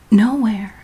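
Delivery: usual speaking voice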